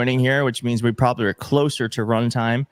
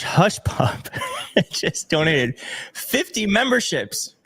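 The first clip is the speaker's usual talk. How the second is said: laughing in disbelief; 5 semitones higher, slower than usual. Real, not acted. Huge donation surprises streamer.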